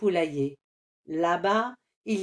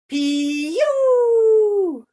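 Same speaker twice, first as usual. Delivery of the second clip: long, very high, loud chick cry; chick peep; 11 semitones higher, 9 dB louder than usual. Acted. Le poussin naît. (le poussin crie piou, très aigu)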